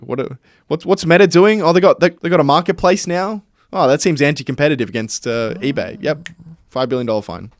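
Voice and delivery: mock nosy regulator. Podcaster plays European Commission hunting for a fine, mock suspicious. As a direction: feign sudden suspicion.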